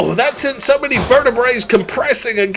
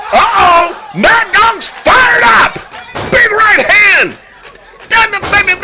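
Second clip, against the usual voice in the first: loud, high, shouted ringside calls; excited play-by-play; 8 semitones higher, 3 dB louder than usual. Acted. Commentator yelling over a wrestler's big comeback, loud and high. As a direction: call the comeback at the top of your lungs.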